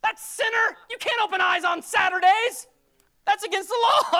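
high, very loud indignant shouting; mock outrage. Pharisee condemning the Sabbath healer, hammed-up outrage. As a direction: shout it like a scandalised accuser.

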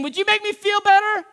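high, loud whiny plea; self-pitying plea. Retold or imitated. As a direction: ask to feel better, pitying yourself. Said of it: "he's not just saying".

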